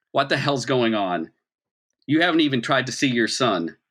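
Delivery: normal talking voice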